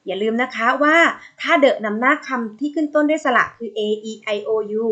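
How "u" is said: ordinary talk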